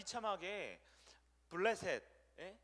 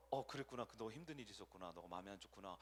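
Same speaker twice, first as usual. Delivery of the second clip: softer, lower soothing; gentle comfort; 9 semitones lower, 7 dB quieter than usual. Retold or imitated. Pastor gently consoling the upset team leader, softer and lower. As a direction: ease them down kindly.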